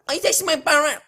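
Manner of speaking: high, loud Colombian accent impression; Sofia Vergara accent. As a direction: put on a thick Colombian accent. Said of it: "Sofia Vergara".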